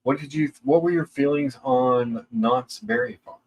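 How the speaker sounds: ordinary delivery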